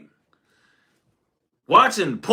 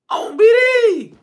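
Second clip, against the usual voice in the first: mock outraged yell; 13 semitones higher, 4 dB louder, slower than usual. Retelling the bike fight escalating.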